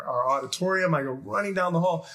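normal talking voice

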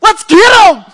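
very high, loud charge yell; rally the troops with a shout; battle cry. Acted. Israelite soldiers charging the Philistines, loud battle cry.

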